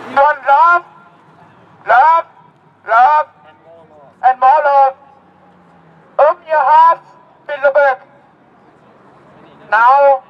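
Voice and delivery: loud repeated calls of love; calling out. Real, not acted. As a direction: call out to the building. Protester calling out.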